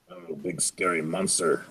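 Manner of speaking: low, soft mock monster voice. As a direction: play a big scary monster, lightly. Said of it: "I am a horned beast".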